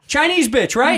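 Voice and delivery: imitated loudmouth, high-pitched; super loud. Airport fan talking about a comic, super loud. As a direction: call it out high, checking for agreement. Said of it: "was talking super loud".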